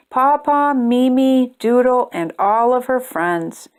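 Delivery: usual speaking voice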